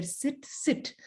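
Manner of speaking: soft whispered sit, sit; whispered calming advice to the bird. Retold or imitated. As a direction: hush it out gently like the wise rat. Rat ends calm advice.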